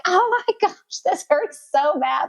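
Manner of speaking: high-pitched mock wail; hammed-up agony sliding down in pitch; retold pain. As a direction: act out complaining in labour, starting very high. Acts out labour pain.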